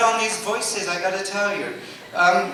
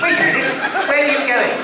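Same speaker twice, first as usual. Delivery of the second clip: high, anxious wait a minute; fussy robot call; 7 semitones higher, 4 dB louder, faster than usual. Acted. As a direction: call after him like a fretful butler robot. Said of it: "acting C-3PO scene".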